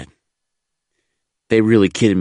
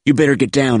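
raised, louder warning yell; urgent yell; 6 semitones higher, 5 dB louder than usual. A narrator's reading. Marine warning a veteran as bullets crack around, yelled. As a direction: yell a warning over gunfire. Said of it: "I yelled".

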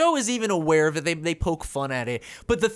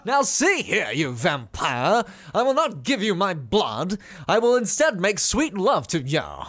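Steven Toast as Sookie. Pretend. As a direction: overact it in a swooping put-on accent. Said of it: "Steven Toast as Sookie".

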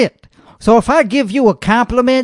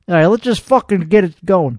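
ordinary talk, nothing marked

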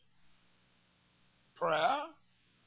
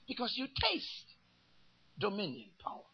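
hushed savouring; 9 dB quieter than usual. Acted. Preacher savouring the word power.

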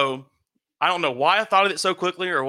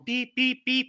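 high, even electronic beeping; imitated alarm beeps; 8 semitones higher, slower than usual. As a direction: mimic an alarm panel beeping. Imitates the fire board's alarm.

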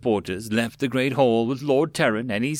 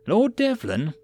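high, questioning call; 9 semitones higher, slower than usual. A narrator's reading. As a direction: call out to find the lord. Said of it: "another voice called out".

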